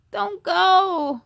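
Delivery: put-on boy begging grandma not to leave, pleading. A narrator's reading. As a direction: beg her to stay. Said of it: "pleaded B J".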